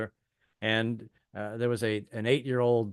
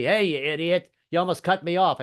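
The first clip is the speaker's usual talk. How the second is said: higher-pitched mock road rage; irate driver; 5 semitones higher, 4 dB louder than usual. Driver pulling up after being cut off, mildly raised angry voice. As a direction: call it angrily across to the next car. Other driver shouting from next car.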